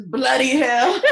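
laughing imitation of a British accent; drawn-out mock British swearing. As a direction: mimic her British accent, amused. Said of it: "turns on her British accent".

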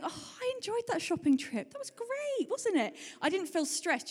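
high, delighted mock sigh of satisfaction; acted-out pleased customer, high and light; happy shopper. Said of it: "a really happy checkout person".

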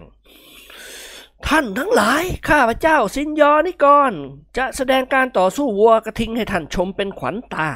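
high, slow grand announcement; drawn-out theatrical proclamation; pompous showman. A narrator's reading. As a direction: ham it up like a circus ringmaster.

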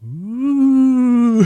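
high, loud spooky ooh to scare them; spooky ghost ooh. Pretend. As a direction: wail like a ghost for fun. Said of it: "I would totally be like".

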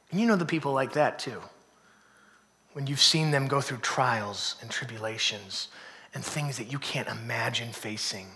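low, soft reverent preaching; hushed sermon. Acted. Pastor softly describing graceful sufferers, hushed. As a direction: drop it to a near hush.

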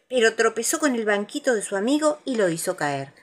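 ordinary delivery, nothing marked

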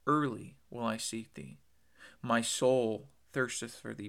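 "Early will I seek thee. My soul thirsteth for thee" read slowly, each word weighed; slow emphatic quote. Shown as a demonstration. Preacher savoring the psalm for the congregation, slow and weighty. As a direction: draw out each phrase slowly. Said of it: "he shows us".

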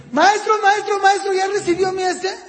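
high, insistent imitation of a pestering schoolchild; eager pupil. Child on Zoom asks if teacher got the work, high and insistent. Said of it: "hay cuatro Zooms".

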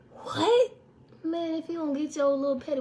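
sharp high incredulous what; stunned what. Real, not acted. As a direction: shoot it up in disbelief. Shocked reaction to his claim.

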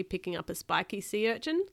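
plain reading voice

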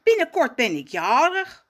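cheerful call. Acted. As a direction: call out with joy about the birthday. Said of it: "roept hij vrolijk".

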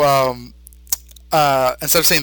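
ordinary delivery

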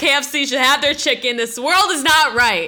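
impassioned retold grievance; indignant rant. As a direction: declare it like a wronged customer. Stranger's retold mock-outraged rant.

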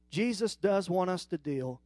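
usual speaking voice

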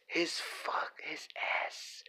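unvoiced hushed correction; whispering. Real, not acted. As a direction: whisper it under your breath.

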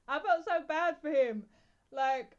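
usual speaking voice

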